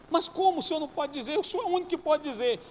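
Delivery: a patient demanding the truth; indignant patient. Retold or imitated. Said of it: "ele falava pro médico". (paciente indignado, voz bem mais aguda)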